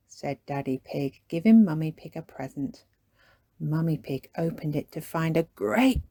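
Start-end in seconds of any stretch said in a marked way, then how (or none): none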